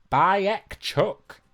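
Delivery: high, loud and slow; loud high announcement. Pretend. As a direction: belt it like a game host. Shouting a silly quiz title.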